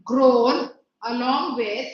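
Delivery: very slow, phrase-by-phrase dictation; unhurried teacher reading out notes to copy; classroom dictation. Acted. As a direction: dictate with long pauses between chunks. Dictates the answer for writing.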